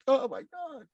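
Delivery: high, tearful cry; retold crying. Told he is a real superhero, voice breaks crying. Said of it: "I start crying".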